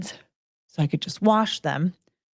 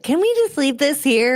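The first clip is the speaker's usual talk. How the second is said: higher-pitched retold request; sister's pleading ask; 7 semitones higher than usual. Retelling her sister's request to leave things here, lightly pleading. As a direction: lift it lightly, imitating a sister asking.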